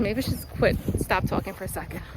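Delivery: ordinary delivery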